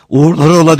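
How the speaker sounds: high, loud send-off; shouted farewell. A narrator's reading. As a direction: call goodbye up to a train. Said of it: "diye bağırdı birisi aşağıdan". (aşağıdan bağırarak uğurlama)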